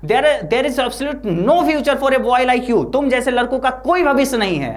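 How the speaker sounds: teacher telling Sebi he has no future, mock rage; acted furious scolding. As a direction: condemn the boy like an angry teacher. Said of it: "वो चिल्लाते हैं".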